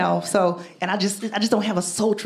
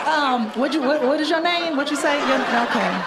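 mock flirt; 7 semitones higher than usual. Mock flirting with stuffed nose.

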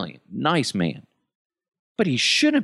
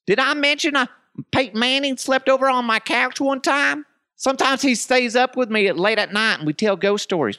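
bragging name-dropper; 13 semitones higher than usual. Retold or imitated. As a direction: brag like an excited kid. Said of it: "bragging about his friends".

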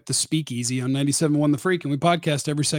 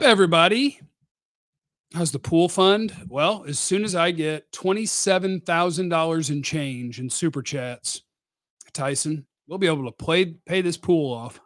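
usual speaking voice

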